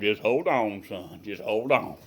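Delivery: low, soft and steady; calm dad. Retold or imitated. Dad calmly tells his son on the door to hold on, soft and steady. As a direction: keep it unhurried and calm. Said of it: "My daddy always calm".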